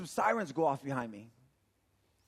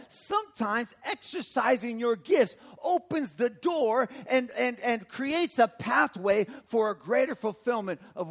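loud, raised, emphatic preacher voice; preaching at full force; 6 semitones higher, 5 dB louder than usual. Real, not acted. Preacher urging people to use their gifts, forceful preaching.

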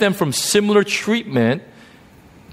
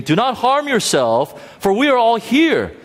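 loud cry; 8 semitones higher than usual. A narrator's reading. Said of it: "cried out with a loud voice".

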